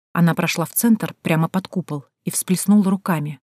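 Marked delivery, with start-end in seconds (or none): none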